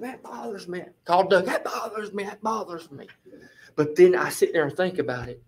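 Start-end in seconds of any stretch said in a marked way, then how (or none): 0.0-3.1 very soft, retold fretting; mock fretting, barely voiced; quiet fretting. He complains to Chris and Doug, quietly fretting.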